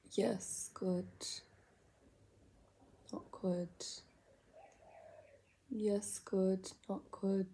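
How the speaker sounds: soft unvoiced muttering; whisper. Real, not acted. Whispering to herself while gluing on eyelashes. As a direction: murmur to yourself while focusing.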